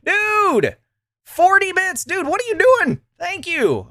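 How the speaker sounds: very high-pitched delighted disbelief; real surprise in a high, playful voice; amazed thanks. Real, not acted. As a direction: squeal at a generous surprise gift. Reacts to a viewer's donation.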